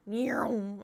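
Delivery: drawn-out "neeow" whizzing by; imitated zooming cat. Streamer mimicking the cat heard from the kitchen, drawn-out zoom. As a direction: stretch out a racing-past sound effect.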